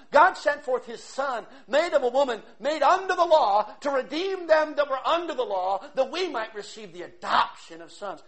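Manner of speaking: loud, high preacher's cry; sermon at full volume; fiery preaching. Acted.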